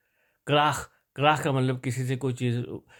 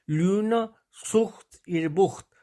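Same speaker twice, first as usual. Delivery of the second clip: slow, higher-pitched German model reading; reading practice sentence; 6 semitones higher, slower than usual. Shown as a demonstration. Teacher reads before translating, slow and deliberate. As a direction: spell the sentence out word by word. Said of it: "وہ میں آپ کو پڑھ دیتا ہوں".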